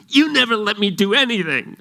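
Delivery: put-on high, loud whiny teenage outburst; indignant protest. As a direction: stamp your foot and protest it. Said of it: "storm into the house saying".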